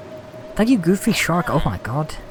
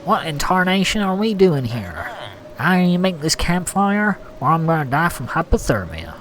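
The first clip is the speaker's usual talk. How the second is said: put-on American accent; 3 semitones higher than usual. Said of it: "I'll do an American accent".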